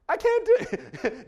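high, loud, hammed-up I can't; mock panic. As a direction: wail it like a terrified newcomer. Retold first-job panic.

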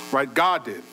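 usual speaking voice

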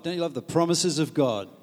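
ordinary talk

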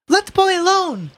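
high, loud heckle; voice from crowd. Acted. Bystander defending Ignatius to the policeman, calling out. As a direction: call out sharply over a crowd. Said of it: "a voice said from the crowd".

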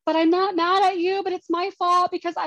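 high, pleading apology retold with a hint of tears; retold tearful pleading. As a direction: plead high and small, taking the blame. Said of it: "I was shaking and I was crying".

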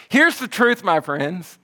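high, loud preaching burst; emphatic proclamation. Real, not acted. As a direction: proclaim it to the congregation. Preacher proclaims the gospel truth.

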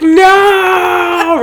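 long, high, loud wail of refusal; shouted protest. Real, not acted. Fan rejecting a slam on Rise of Skywalker, shouted protest. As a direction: shout it high and hold the vowel.

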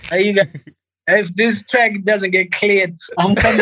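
high, laughing pretend warning; laughing mock threat. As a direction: threaten through a laugh, playfully.

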